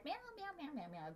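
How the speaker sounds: mock cat meowing, first one high; cat meows. Asking a cat a question in meows. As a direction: meow at the cat like a cat. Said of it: "ask a cat".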